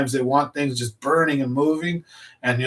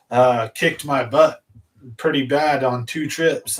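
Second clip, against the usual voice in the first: normal talking voice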